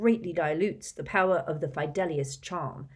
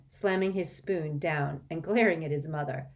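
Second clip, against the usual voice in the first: usual speaking voice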